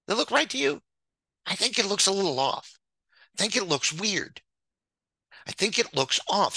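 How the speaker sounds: incredulous disbelief. Real, not acted. Staring at the ratings, high-pitched disbelief. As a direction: squeak in disbelief at the numbers.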